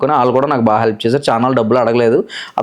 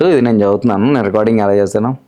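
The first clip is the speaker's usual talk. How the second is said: ordinary talk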